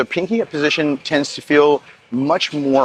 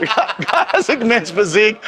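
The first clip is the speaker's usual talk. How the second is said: laughing; 7 semitones higher, slower than usual. Real, not acted. Joking about the physique name.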